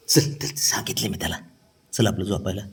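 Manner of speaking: soft whispered coaxing. Real, not acted. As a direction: whisper it so as not to wake anyone. Whispering while coaxing to bed.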